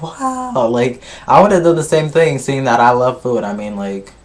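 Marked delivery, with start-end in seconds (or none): 0.0-0.7 drawn-out, high-pitched wow of amazement; mock-amazed, high and stretched; awed wow. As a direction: imitate someone wowed, voice lifted high. Amazed at the sight of fridge.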